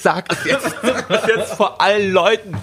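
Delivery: slow pretend begging breaking into laughter; playfully insist, laughing as the pitch climbs; mock demand, laughing. Pretend lover demanding public affection from Dennis, laughing mock insistence. Parodies forced declarations of love, laughing.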